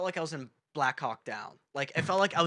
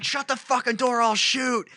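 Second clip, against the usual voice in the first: high, loud cop command; retold shouted threat; 9 semitones higher, 5 dB louder than usual. Imitating cop with taser out, shouted threat. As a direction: bark a frantic armed warning. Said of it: "he had his taser out".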